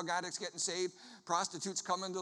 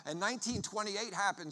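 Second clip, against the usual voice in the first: usual speaking voice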